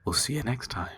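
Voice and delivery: soft, hushed podcast host sign-off. Shown as a demonstration. Asking co-host to close the show, podcast voice. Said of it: "in your best podcast voice".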